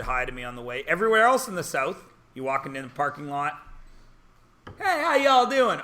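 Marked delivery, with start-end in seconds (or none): 4.8-5.8 high, slow Southern drawl; friendly mock Southern hello; Southern greeting. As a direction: greet him like a chatty local.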